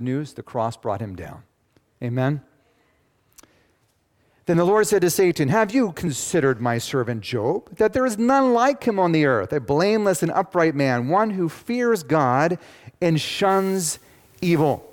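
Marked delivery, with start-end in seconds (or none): 4.5-14.9 loud, higher, driving preacher read; preaching scripture. Acted. Pastor booming out God's words to the congregation. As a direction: proclaim it to the congregation.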